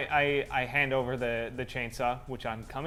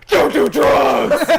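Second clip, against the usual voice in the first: loud, high, slow yell; shout; 13 semitones higher, 18 dB louder, much slower than usual. Acted. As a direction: yell it as you spray. Pepper spraying gangsters and yelling.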